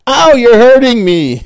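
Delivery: high, loud, drawn-out mock yelp; piercing imitation of a whiny complaint; pained drunken cry. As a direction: wail it like a hammed-up drunk being grabbed. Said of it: "all we heard was".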